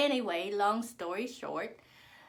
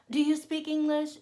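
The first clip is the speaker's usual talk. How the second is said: high, loud mimicked question; mock someone talking down to a foreigner, pitched up; imitated rude, loud questioning; rude stranger; 7 semitones higher, 7 dB louder than usual. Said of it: "That's rude".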